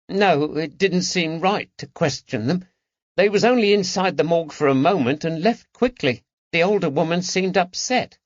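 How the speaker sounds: put-on high London boy's voice; the lad proudly reporting clues; eager boy. A narrator's reading.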